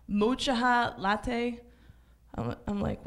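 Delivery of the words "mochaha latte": "mocha" said MOH-chuh-hah, slowly drawn out; mispronounced mocha. Retold or imitated. Customer ordering at the Israeli restaurant, mispronouncing "mocha" as MOH-chuh-hah. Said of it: "it's not pronounced mocha".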